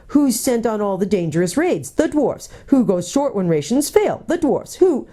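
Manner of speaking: rising, high-pitched indignant questions and answers; indignant rant. A narrator's reading. Dwarf's angry rant, cut off.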